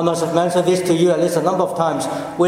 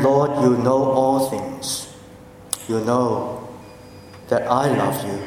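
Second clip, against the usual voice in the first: grieved reply; 7 semitones lower, 3 dB quieter than usual. Acted. Peter, grieved at the third question, answers softly. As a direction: let the hurt sink the voice down. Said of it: "And Simon was grieved".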